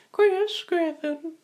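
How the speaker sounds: put-on very high, slow creepy old man; Herbert. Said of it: "creepy old man".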